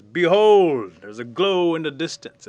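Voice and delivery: grand proclamation. Acted. Bear calls the animals to a council meeting, grand proclamation. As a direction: announce it to a gathering.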